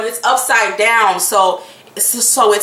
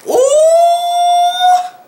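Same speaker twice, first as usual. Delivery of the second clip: much higher, louder ooh; high surprised ooh; 5 dB louder than usual. Real, not acted. Loud high exclamation.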